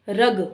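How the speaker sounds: रग said on its own with a plain oral vowel; रग firmly, no n before the g; no nasal sound. Shown as a demonstration. Teacher shows the word without a dot, रग with no nasal. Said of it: "इसमें किसी प्रकार की कोई बिंदी नहीं लगी".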